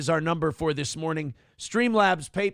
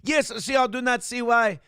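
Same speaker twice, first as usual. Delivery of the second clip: put-on French accent; 6 semitones higher than usual. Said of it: "He's turning French now".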